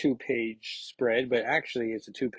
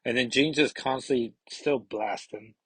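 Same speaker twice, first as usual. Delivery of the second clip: ordinary delivery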